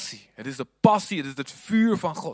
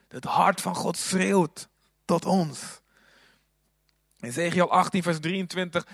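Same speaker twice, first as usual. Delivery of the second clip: usual speaking voice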